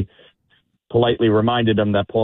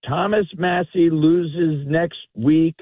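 retold scolding, higher in pitch; chastising; 8 semitones higher than usual. Drunk man blames a stranger's people, mock chastising. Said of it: "chastising me".

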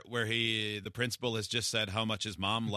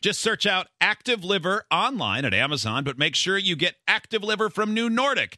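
loud, high, energetic ad voice; ad read; 7 semitones higher, 7 dB louder than usual. Acted. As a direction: hype up the product.

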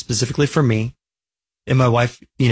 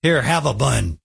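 mock meat-eater. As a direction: grumble dismissively, imitating a careless host. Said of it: "a lot of people are just like".

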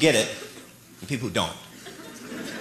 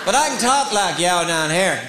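ordinary talk

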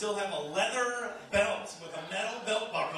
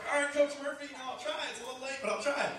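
put-on meek kid reply; 8 semitones higher, faster than usual.

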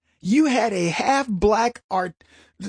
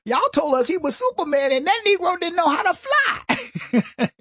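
high stand-up comic punchline voice; imitating Cat Williams; 8 semitones higher than usual. Host quotes a comedian's joke about Obama, high comic voice. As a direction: deliver it high, like a comic on stage. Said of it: "He said".

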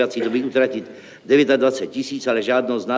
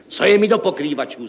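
loud, raised-pitch outburst; impatient exclamation; 4 semitones higher, 5 dB louder than usual. A narrator's reading. Director dismisses talk of the roofers, impatient exclamation. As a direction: burst out, brushing the excuse aside. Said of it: "zvolal podnikový ředitel".

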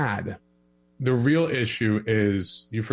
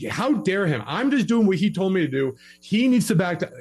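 put-on indignant girlfriend; 7 semitones higher than usual. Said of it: "And the girl's like".

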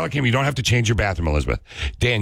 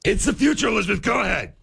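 much higher-pitched, excited pitch; excited insistence; 12 semitones higher than usual. Real, not acted. Host selling toilet camera to balking co-host, excited and insistent. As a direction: push the hype right up high. Host urging co-host who said stop.

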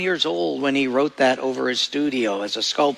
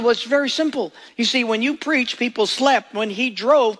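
quoting the taxi driver; 7 semitones higher than usual. Retold or imitated. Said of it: "He said".

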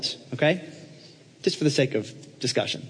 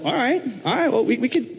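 lightly voiced hesitant mentor, higher pitched; Paul; 10 semitones higher than usual. Paul answering his would-be apprentice, high and hesitant. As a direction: stall a little, pitched up. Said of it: "And Paul's like".

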